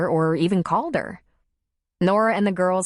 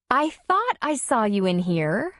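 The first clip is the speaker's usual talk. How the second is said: a hint of a higher, sweeter woman's voice; Darla, lightly voiced; 5 semitones higher than usual. A narrator's reading. Darla greeting Tom in his office, lightly voiced. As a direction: lightly play the girlfriend.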